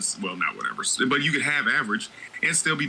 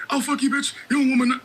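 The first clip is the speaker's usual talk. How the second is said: much higher, louder mock insult; imitating a rude catcaller, hammed up; mock catcaller yell; 3 dB louder than usual.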